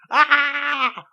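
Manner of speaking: loud high-pitched horror-movie scream imitation; imitated shrill scream. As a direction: shriek like the woman in the cinema. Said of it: "she's screaming, went".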